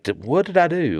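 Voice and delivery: high, soft-edged put-on confusion; mild mock puzzlement; bewildered. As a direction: play the baffled husband. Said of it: "I would just be like".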